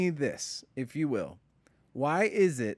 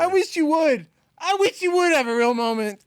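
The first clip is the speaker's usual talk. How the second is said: high, loud sarcastic mockery; mocking; 12 semitones higher, 8 dB louder than usual. Real, not acted. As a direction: throw the words back mockingly. Mocking friend's demand.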